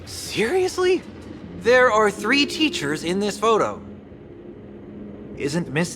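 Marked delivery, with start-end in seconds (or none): 0.1-1.0 high-pitched, rising disbelief; incredulous. Acted. Disbelief at classmate's miscount.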